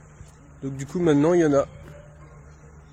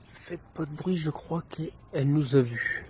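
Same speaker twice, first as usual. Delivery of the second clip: hushed, sneaking up; whispering while hiding; 7 dB quieter than usual. Real, not acted. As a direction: whisper so it won't hear. Sneaking up on the deer.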